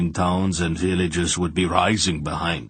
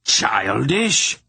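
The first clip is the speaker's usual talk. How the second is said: higher outburst swinging up into outrage; exploding in anger; 5 semitones higher, 4 dB louder than usual. A narrator's reading. Emperor repeating his daughter's insult, exploding. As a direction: throw the word back, incredulous and furious. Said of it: "The Emperor exploded".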